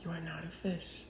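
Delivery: lock eyes and say it softly; quiet intense stare. Retold or imitated. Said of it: "he's like".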